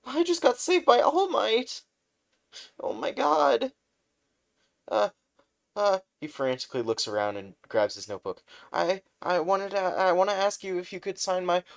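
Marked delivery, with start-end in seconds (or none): 0.0-6.0 much higher, louder squeal of joy; flustered fanboy shout. Acted. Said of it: "really flustered". 8.7-11.6 much higher, stammering excited voice; nervous fan asking his idol to sign; flustered fanboy. Acted.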